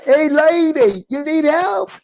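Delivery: high, drawn-out holler; screamed call. Retold or imitated. Passing driver to struggling woman, high slow shouted offer. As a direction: imitate a man yelling out to a stranger. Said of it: "he screams out".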